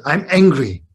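imitating a child's loud declaration of anger; mildly mock-angry, with a loud push; grandchild's anger. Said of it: "Let's say she said".

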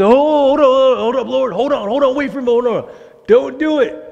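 high, loud frantic begging; pleading Moses. Retold or imitated. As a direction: plead high and urgent like Moses stopping God.

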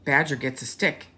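lively storybook read-aloud; rising to a high, loud finish; reading to children. A narrator's reading. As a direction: read it with big storybook energy. Storybook narration.

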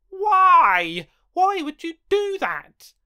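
high, loud despairing why; anguished outcry. Acted. Character crying out at the reader after the lights go out. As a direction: wail at the reader's betrayal.

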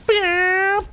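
high playful bam for a gunshot; mouth bam sound. Pretend. Imitating sniper shot.

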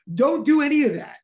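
excited yelling. Real, not acted. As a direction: yell it out of pure excitement. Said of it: "If I'm yelling, it's 'cause I'm excited".

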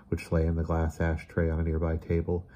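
ordinary delivery

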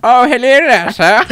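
high, loud mock-formal greeting; posh greeting. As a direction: greet him like a fancy gentleman. Greets peeping friend theatrically.

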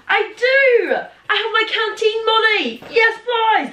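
high, loud delighted squeal; excited. Real, not acted. Finding old canteen money in her bag, squealing with joy.

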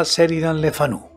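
ordinary delivery, nothing marked

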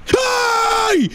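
high, drawn-out yelped hi; startled greeting. Acted. Player walking in on someone in the bathroom, startled. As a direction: shriek an awkward hi, stretched out.

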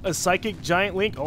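normal talking voice

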